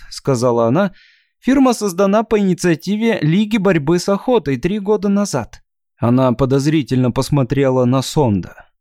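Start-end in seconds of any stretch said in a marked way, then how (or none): 1.4-5.6 put-on high chirping girl voice; chirpy thin voice. Shatra's voice continued.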